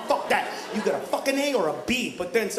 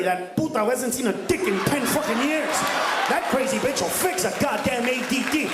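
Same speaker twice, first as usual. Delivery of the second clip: ordinary delivery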